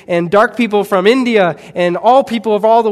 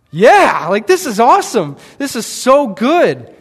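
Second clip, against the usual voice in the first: excited; 6 semitones higher, 5 dB louder than usual. Retold or imitated. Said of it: "We're like".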